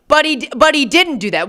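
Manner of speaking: usual speaking voice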